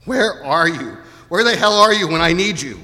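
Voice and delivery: anguished, accusing plea; crying out to God. Acted. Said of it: "where we've cried out".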